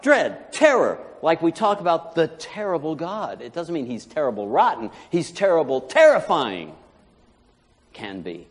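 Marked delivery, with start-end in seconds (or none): none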